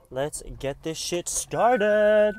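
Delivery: hype. Acted. Hyping herself up to start.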